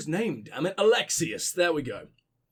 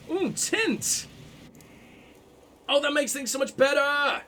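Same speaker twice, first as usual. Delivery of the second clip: excited; 7 semitones higher than usual. Real, not acted. Delighted at unlocking tinting.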